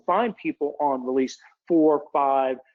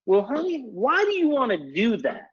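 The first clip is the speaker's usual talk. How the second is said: much higher Southern drawl, sweetly coaxing "honey"; Gina Leonard's Southern drawl; 9 semitones higher than usual. Retold or imitated. Consultant pinning down a customer on why, in a higher Southern drawl. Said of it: "she'll ask in a very Southern drawl".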